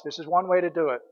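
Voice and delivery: ordinary talk, nothing marked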